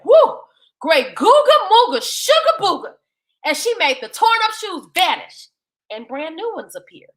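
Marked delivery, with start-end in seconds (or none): 0.0-3.0 higher, louder playful spell chant; Sunday casting her magic. Acted. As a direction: cast the spell with a flourish.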